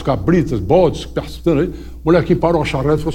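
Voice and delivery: ordinary delivery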